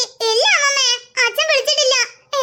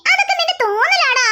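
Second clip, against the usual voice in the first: ordinary delivery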